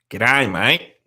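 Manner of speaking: "G'day, mate" in a broad Australian accent; broad Aussie g'day. Shown as a demonstration. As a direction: give "G'day, mate" the full Australian sound. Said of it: "I used to have a good g'day".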